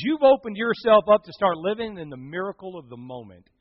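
ordinary delivery

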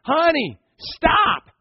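high, loud father's warning shout; yelling out. Acted. Father calling his little girl away from danger, yelling out. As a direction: shout an urgent warning across the yard. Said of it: "And he yells out".

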